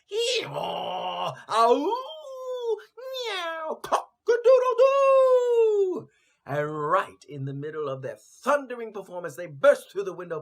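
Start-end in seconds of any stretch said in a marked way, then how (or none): 0.1-6.1 braying, howling, mewing, crowing, loud and high; animal chorus. A narrator's reading. The four animals crying out at once to scare robbers, loud. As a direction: shout out each animal cry in turn. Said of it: "The unlikely chorus went something like this".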